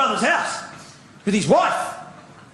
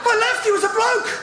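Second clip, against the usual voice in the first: high-pitched, baffled comic rant; incredulous outrage; 10 semitones higher than usual. Acted. Comic demanding what happened to Bert, incredulous. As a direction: climb high in disbelief. Mock outrage over Bert's changed looks.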